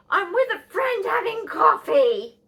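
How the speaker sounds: high, very loud mock mum yell; loud, high-pitched retort, playing her mother; imitated mum shouting. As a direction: holler indignantly as an interrupted mum. Mum snapping down the phone.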